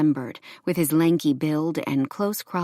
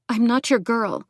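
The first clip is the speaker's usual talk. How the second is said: higher, controlled refusal; forced steady; 7 semitones higher than usual. A narrator's reading. Woman refuses her armed ex, forced steady. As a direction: keep your voice steady under threat. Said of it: "forcing her voice to remain steady".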